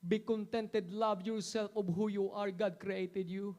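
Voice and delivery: shouting, crying preacher climbing to a roar; impassioned sermon building to a shout; full-force preaching. Acted. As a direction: preach it with everything, rising to a cry.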